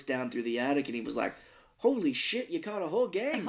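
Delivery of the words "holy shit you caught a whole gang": high, swooping mock astonishment; amazed, hammed-up movie quote; amazed husband. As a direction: exclaim it high, imitating a startled husband. Husband's astonished reaction, acted.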